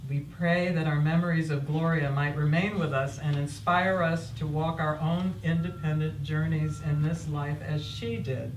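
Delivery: normal talking voice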